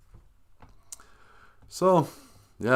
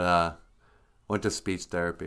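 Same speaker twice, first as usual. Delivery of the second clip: ordinary talk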